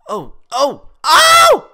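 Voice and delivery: short yelps building to a loud, high scream; escalating startled cries. Real, not acted. Startled cries during play.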